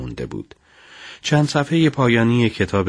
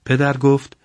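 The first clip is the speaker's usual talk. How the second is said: usual speaking voice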